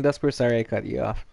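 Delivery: ordinary delivery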